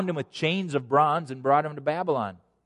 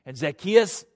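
ordinary talk

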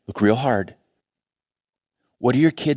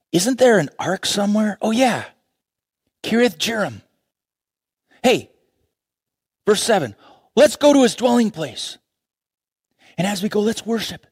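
eager David; 8 semitones higher than usual. Acted. David eager to fetch the ark.

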